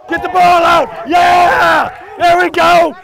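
loud cheering shout; excited yell. Real, not acted. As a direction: celebrate the strip at full voice. Coach cheering defender stripping ball.

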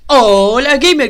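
agudo, fuerte y lento, alargando el saludo; saludo enérgico de youtuber. Acted. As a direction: abre el video con mucha energía. (saludo de streamer, agudo y arrastrado)

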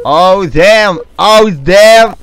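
high, loud amazed shout; excited exclamation. Real, not acted. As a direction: blurt it out in disbelief. Reacción a una jugada. (exclamación emocionada, aguda y fuerte)

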